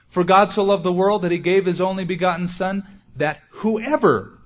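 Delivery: single out whoever, high and lingering; stress on whoever. Shown as a demonstration. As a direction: run loud through the verse, then stretch whoever. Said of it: "emphasize those words".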